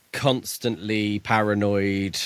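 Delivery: ordinary talk, nothing marked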